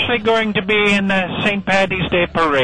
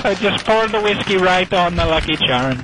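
broad comic Irish brogue; put-on Irish accent; 2 semitones higher than usual. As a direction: play a jokey stage Irishman with a brogue.

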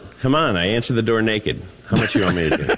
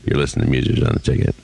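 much lower, low magnetic radio voice; deep voice; 9 semitones lower, much faster than usual. Shown as a demonstration. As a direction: drop it low like a radio announcer. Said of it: "Do it deep".

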